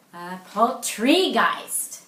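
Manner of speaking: "poultrygeist" drawn out very slowly and loud, leaning on geist; stress on geist. Shown as a demonstration. Teacher demonstrating a joke's stress to the class, slow and loud. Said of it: "the emphasis is on the wrong syllable".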